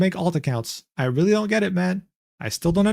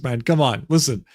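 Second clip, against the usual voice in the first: ordinary delivery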